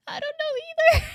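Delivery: high, loud laugh through the words. Real, not acted. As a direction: crack up while speaking. Breaks into laughter while talking.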